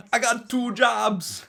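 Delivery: high, slow squeal; hammed-up quote. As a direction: stretch it out, way up high. Quoting the show, high and slow.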